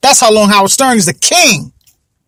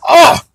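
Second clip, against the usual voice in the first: disgusted ugh; 8 semitones higher, 3 dB louder than usual. Real, not acted. Disgust at a celebrity.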